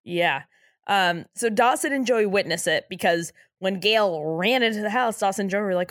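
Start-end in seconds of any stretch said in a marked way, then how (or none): none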